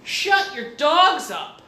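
imitated neighbor's drawn-out, high yell; retold yell. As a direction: holler it slowly and loudly, as the complaining neighbor. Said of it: "Somebody from the church yells".

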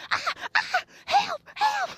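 high-pitched hammed-up cries of help; mock cries for help. Imitating her mother crying for help in shallow water, high mock panic. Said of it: "And I'm hearing".

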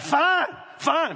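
high, loud defiant shout; defiant sister. Retold or imitated. Sister defying their mother, loud defiant shout. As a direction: fling it back at mom. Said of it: "Tracy's like".

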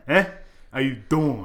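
mock New York "How you doing?"; a tough-guy New York greeting, lightly put on; New York accent. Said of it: "I have to do the stereotype".

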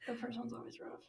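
whispered practice of the intro; whispering. Real, not acted. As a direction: mouth the lines in a whisper.

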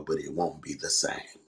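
ordinary delivery

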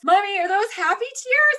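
put-on high, loud little-girl voice; little daughter. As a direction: imitate a sweet child asking Mommy. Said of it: "And she goes".

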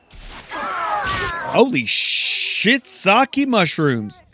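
real shock as the party gets wiped out; a gamer yelling in real shock as his whole party dies at once; shocked outburst. Real, not acted.

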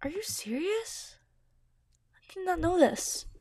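breathy disbelieving whisper; stunned whisper. Real, not acted. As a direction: whisper it in shock. Shocked at the reveal.

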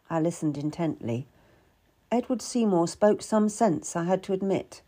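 usual speaking voice

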